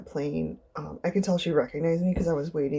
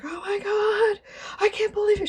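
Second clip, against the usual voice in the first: very high, loud mock squeal; hammed-up squeal of amazement; starstruck disbelief; 4 dB louder than usual. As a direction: mimic a fan who can't believe her eyes. Imitates the disbelieving starstruck woman.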